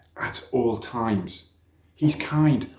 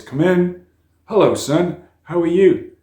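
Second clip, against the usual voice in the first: higher, louder welcoming father; hearty greeting; 8 semitones higher, 9 dB louder than usual. A narrator's reading. The president greeting his son at the door, hearty and loud. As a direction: boom out a cheerful welcome.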